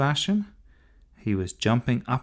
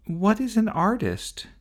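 put-on high, small curious boy voice; little brother; 12 semitones higher than usual. Little brother asking his sister, childlike high voice. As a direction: ask it like a puzzled little boy.

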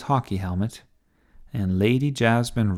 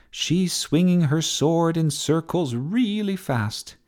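high, drawn-out play-by-play; TV commentary; 6 semitones higher than usual. A narrator's reading. Sophie describing Jasmine's whirling sword, excited commentary. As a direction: marvel at the sword move. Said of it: "doing the commentary for T V".

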